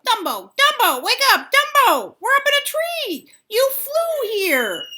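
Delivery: loud high excited shouts; excited shouting. A narrator's reading. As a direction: wake him up shouting. Waking Dumbo excitedly.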